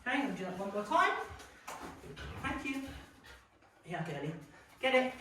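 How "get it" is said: normal talking voice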